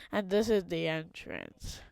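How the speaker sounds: normal talking voice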